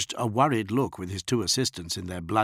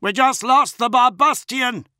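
higher, louder panicked yell; 9 dB louder than usual. A narrator's reading. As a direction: shout the bad news. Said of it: "someone yelled".

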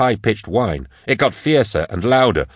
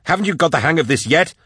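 high, urgent scolding; pitched-up, heated protest; exasperated yelling; 8 semitones higher than usual. A narrator's reading. Continuing yelled argument, cut off.

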